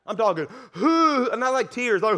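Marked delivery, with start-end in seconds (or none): 0.7-1.3 a high, loud mock bawling hoo; imitated sob. Pastor imitating the businessman's loud bawling hoo. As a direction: imitate a grown man wailing. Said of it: "And he starts bawling".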